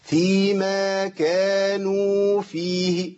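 slow chanted tajweed recitation; slow Quran recitation. Shown as a demonstration. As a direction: recite slowly with measured lengths. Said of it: "وتسمعوها مني كده موزونة". (تلاوة مجودة بطيئة)

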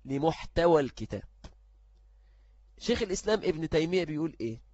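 ordinary talk, nothing marked

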